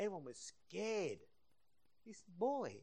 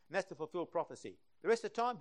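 ordinary talk, nothing marked

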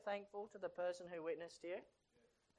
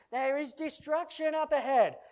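normal talking voice

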